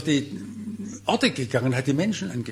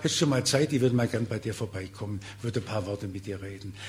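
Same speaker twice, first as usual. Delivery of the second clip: fading softer and softer; gentle request; 5 semitones lower, 5 dB quieter than usual. Pretend. Preacher modelling a visit request, gentle and fading. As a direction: ask kindly, letting the voice sink.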